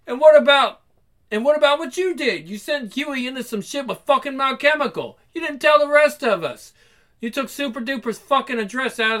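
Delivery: acted outrage, pitched well up; indignant accusation. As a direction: throw the blame straight back, heated. Acted quarrel, accusing a colleague.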